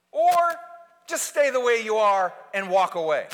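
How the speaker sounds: loud, high fervent preaching; thundering pulpit voice; full-force preaching. Acted. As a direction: preach it out to the back row.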